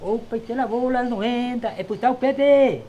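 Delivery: bingo caller. Retold or imitated. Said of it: "una música de la [name]".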